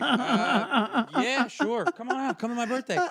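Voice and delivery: high, loud, laughing through the words; laughing mock reply. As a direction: play it as a giddy, laughing parody. Mocking friends' eager reply.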